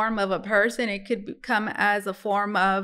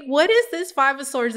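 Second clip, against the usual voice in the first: usual speaking voice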